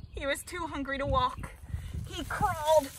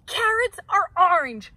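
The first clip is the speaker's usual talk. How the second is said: loud, high indignant shout; 5 semitones higher, 8 dB louder than usual. A narrator's reading. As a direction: yell the objection. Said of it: "shouted Bird".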